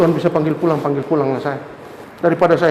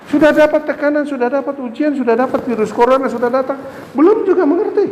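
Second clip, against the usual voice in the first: high, pressing preaching voice; fervent preaching; 9 semitones higher than usual. Acted. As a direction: press the congregation, voice raised high. Preaching rebuke to congregation. (khotbah menggebu dengan suara tinggi)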